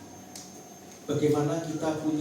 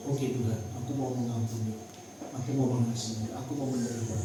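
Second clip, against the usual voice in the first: low, soft surrender; submissive prayer; 7 semitones lower, 7 dB quieter than usual. Acted. Believer agreeing to forgive before God, low and soft. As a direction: pray it quietly, pitched well down.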